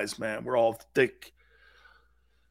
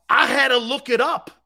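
ordinary talk

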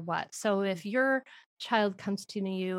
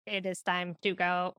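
measured, mechanical robot voice; 3 semitones lower than usual. Shown as a demonstration. Parent playfully telling child it's time to go, robot voice. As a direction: say it like a robot, word by word. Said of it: "The robot's always good".

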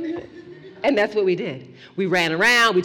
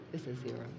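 barely audible, dropping away; hushed dread; 8 semitones lower, 20 dB quieter, much faster than usual. Acted. Storyteller hushing the camera's counter in dread. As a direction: let it fall almost silent with dread.

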